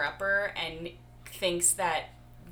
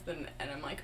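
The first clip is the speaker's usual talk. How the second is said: ordinary talk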